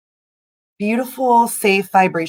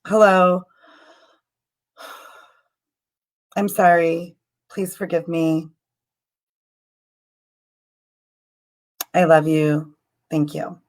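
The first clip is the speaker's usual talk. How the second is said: prayer recitation. Shown as a demonstration. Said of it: "I'm just gonna say that a couple times".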